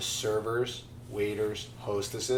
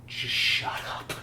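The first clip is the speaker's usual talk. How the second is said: slow whisper; whispered catchphrase; 4 dB quieter, slower than usual. Acted. Friend repeating the college saying, whispered. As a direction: draw it out in a whisper.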